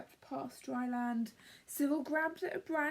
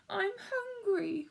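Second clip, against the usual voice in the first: high, slow, whiny squirrel cry; crying out hungry; 8 semitones higher, 4 dB louder, slower than usual. Acted. Cyril crying to Bruce that he is hungry, high and slow. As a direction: wail it like a starving squirrel. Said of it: "cried Cyril".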